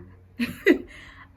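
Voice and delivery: choked, breathy laugh holding back tears; a teary laugh fighting off crying; tearful laugh. Real, not acted. I'm not gonna cry.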